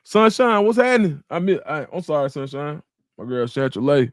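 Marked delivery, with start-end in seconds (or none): 0.0-1.2 calling out a name, higher and louder; excited greeting. Real, not acted. Streamer greeting a viewer, excited call-out. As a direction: hail a friend in the chat with a big hello.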